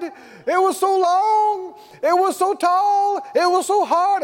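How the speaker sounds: hammed-up lament of hardship; dramatic complaining testimony. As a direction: overdo the hardship for the church. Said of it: "gets up and goes".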